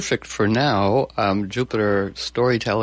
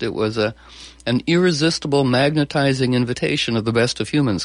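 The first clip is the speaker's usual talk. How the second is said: normal talking voice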